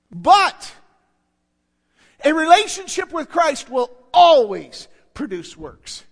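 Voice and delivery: loud, always punched hard; preaching shout. Real, not acted. Preacher proclaiming to the congregation, loud. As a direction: shout it out to the church. Preacher driving home point.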